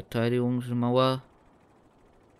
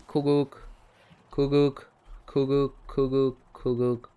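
sing-song higher call, taunting; teasing cuckoo call; 4 semitones higher, 4 dB louder than usual. Pretend. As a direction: tease them like a cuckoo clock. (neckender Kuckucksruf, höher)

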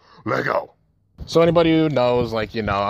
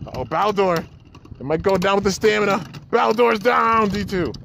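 rising excited call, slowing at the end; 8 semitones higher than usual. Real, not acted. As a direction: build up as it falls. Spinner about to lose.

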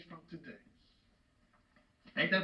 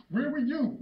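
accusing demand. Acted. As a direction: throw the question at him hard. Father demands answer from son.

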